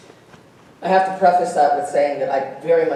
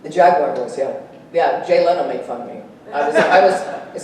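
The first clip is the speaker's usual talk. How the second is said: usual speaking voice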